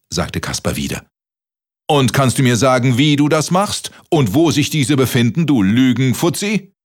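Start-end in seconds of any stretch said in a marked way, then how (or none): 1.9-6.7 high, loud giant demanding. Acted. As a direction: demand an answer, booming. Giant interrogates loudly.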